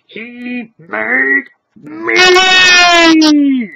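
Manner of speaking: very loud, drawn-out crying shout; anguished cry. Acted. Stranger crying out about his creator, anguished shout. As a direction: wail it out in anguish.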